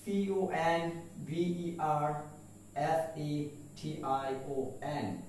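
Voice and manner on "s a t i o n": usual speaking voice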